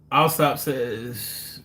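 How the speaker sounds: ordinary talk, nothing marked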